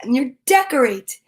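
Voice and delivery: gentle read-aloud of a rhyming picture book; storybook reading. Acted. Parent reading a bedtime rhyme to a child, gentle and unhurried.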